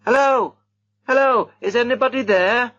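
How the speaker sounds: high calls, projected loud; shouting. A narrator's reading. Reggie searches the dark house, shouting. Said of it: "young Reggie's voice shouting".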